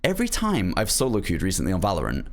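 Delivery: ordinary delivery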